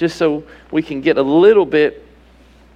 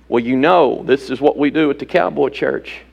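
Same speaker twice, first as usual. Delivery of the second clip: lower, lightly voiced cowboy churchgoer; mock cowboy churchgoer; 6 semitones lower than usual. Preacher mocking Cowboy Church habit, lower mock voice. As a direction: drop low and mimic a smug local.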